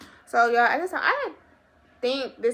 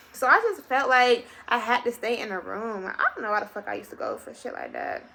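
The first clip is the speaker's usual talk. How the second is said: ordinary talk, nothing marked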